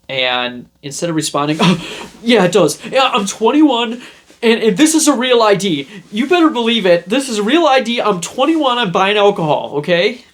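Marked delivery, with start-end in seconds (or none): 1.6-10.2 much higher and louder, laughing nervously; hammed-up panicky insistence; overdefensive protest. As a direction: protest too much to sound legit. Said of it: "I didn't respond that way".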